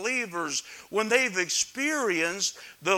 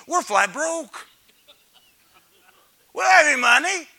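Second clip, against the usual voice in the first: high, loud declaration; emphatic shout; 8 semitones higher, 12 dB louder than usual. Real, not acted. Preacher to congregation, exclaiming loudly. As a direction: shout it to the back row.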